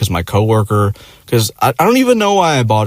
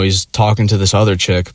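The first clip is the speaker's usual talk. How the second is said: ordinary delivery, nothing marked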